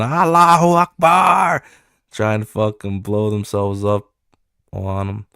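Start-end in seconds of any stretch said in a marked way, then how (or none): 0.0-1.6 put-on high, loud battle cry in Middle Eastern accent; hammed-up war yell, accent on.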